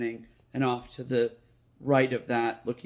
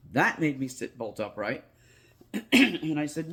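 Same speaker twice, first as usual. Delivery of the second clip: usual speaking voice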